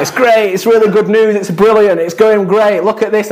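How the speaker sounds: high, bubbly mock cheer; lightly hammed-up delight; mock upbeat. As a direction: gush like everything's perfect. Mimicking film's upbeat buildup.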